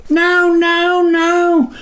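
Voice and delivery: high frantic yelping; tiny creature yelping in panic, very high; Snuffer's yelp. A narrator's reading. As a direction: yelp while dodging blows. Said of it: "the one called Snuffer yelped".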